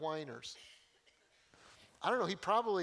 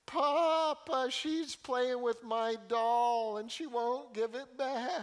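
high, loud, slow tattling whine; loud high whining granddaughter; whiny toddler; 7 semitones higher, 5 dB louder, much slower than usual. Retold or imitated. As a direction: tattle on her sister in a long whine. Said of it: "take whining to an art form".

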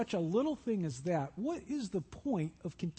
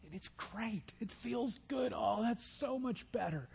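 relieved sigh; 2 semitones higher, 8 dB quieter than usual. Acted. Relief of scratching itch.

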